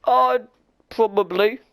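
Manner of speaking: high, offhand dim human; dopey human voice. Acted. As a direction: shrug it off like a clueless sailor. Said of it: "The human goes".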